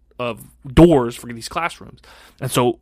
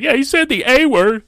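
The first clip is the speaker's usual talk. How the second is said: put-on high, louder tattletale kid voice; mock whiny tattle, pitched up; tattling kid; 11 semitones higher, 5 dB louder than usual. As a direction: squeal it out like a snitching first grader. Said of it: "He's like".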